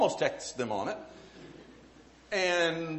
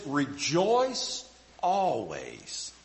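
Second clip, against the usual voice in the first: scripture reading; 3 semitones lower, much slower than usual. Acted.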